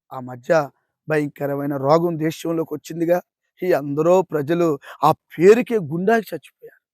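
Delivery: ordinary talk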